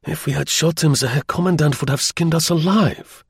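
put-on high, earnest German-accented declaration; fervent. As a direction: say it with heartfelt alarm. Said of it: "he declared fervently".

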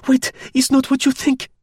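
very high-pitched urgent woman's voice; high frantic cry, accented; shrieking plea. Acted. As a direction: plead shrilly to stop them. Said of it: "shrieked Clotilde".

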